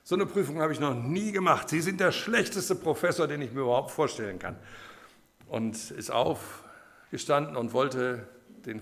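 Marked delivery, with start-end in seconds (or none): 0.0-4.6 high, loud indignant tirade; loudmouthed student outburst. Acted. Cheeky student blasts his professor over an exam, indignant outburst. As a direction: tear into the professor, loud and pitched up. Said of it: "einer der vorlauten Sorte war, der hat dann gesagt".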